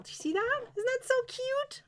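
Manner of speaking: high, cooing delight over something cute; gushing. Real, not acted. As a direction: coo over how cute it is. Gushing over the finished doll.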